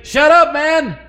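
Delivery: "Shut up, man!" shouted high and loud; mock shout. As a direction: snap at the beggar like the crowd. Crowd hushing blind beggar.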